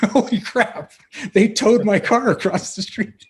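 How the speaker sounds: high, loud, laughing disbelief; holy crap, can you believe it; incredulous laughing exclaim. Retold or imitated. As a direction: blurt it out, amazed and laughing. Laughing disbelief at towed car.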